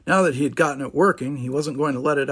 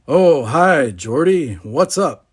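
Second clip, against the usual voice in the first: loud, easygoing hello; relaxed; 9 dB louder, much slower than usual. A narrator's reading. Will answers Geordi's badge call, relaxed and carefree. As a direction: greet a friend without a care. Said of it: "A relaxed voice came on".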